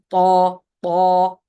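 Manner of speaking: Ta loud and emphatic, tongue pushed up; heavy ta. Shown as a demonstration. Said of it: "raise the tongue".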